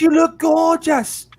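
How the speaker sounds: put-on slow, high Welsh accent. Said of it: "the Welsh accent".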